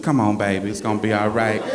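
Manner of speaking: low, soft soothing coo; soothing church mother. Retold or imitated. Church mother hugging him close, low soothing coo. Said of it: "trying to put my head in her bosom".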